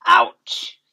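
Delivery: ouch. Acted. Pricked by hedgehog onions.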